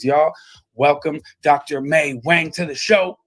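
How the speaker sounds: big, loud showhost announcement; booming, cheerful intro of the guest; excited host welcome. Acted. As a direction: call out the guest's name like a talk show host hyping the crowd. Host calling guest onto show.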